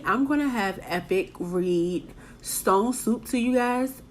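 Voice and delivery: ordinary delivery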